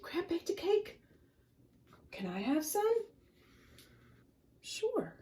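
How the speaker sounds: high, excited little fish voices; high squeaky sea creatures. Acted. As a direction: chatter as frightened fish. Voicing fish characters.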